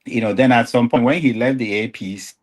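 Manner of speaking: normal talking voice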